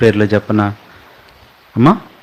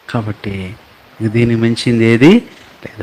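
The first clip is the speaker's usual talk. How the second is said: ordinary delivery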